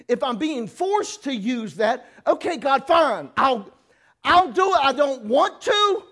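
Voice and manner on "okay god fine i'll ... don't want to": louder and higher, reluctant giving in; grumbling yes to God, loud and high; grudging surrender. Retold or imitated. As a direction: give in through clenched resistance.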